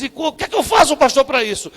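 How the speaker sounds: loud, high imitation of a pleading congregant; mock pleading cry. Churchgoer crying out to the pastor for help, loud and high. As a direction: cry out as a desperate church member.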